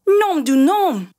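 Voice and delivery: French curse cried out high and loud, slowed; crying out. A narrator's reading. Father scratched by the mouse, high loud slow French curse. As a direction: yelp the oath as the nose is scratched. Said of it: "and cried".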